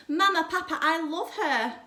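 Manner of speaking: put-on girl thrilled with her new kitten; excited exclaim. A narrator's reading. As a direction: gush with joy over the kitten. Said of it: "exclaimed Clarissa".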